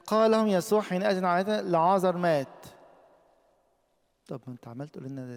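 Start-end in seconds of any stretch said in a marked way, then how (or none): 0.1-2.7 loud, high verse read out to the church; preacher booming the Gospel verse; proclaiming scripture. Acted. Preacher reads the verse aloud.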